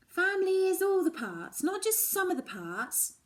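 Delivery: high, loud frustrated insistence; cross. Acted. Daughter arguing with Mum at dinner, high and cross. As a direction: push back, too cross to say it right. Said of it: "I'm too cross".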